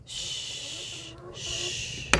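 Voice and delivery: soft, breathy shushing to calm her; hushing shh. Real, not acted. Shushing the panicking chicken Bertha, soft hush. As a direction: hush the hen gently.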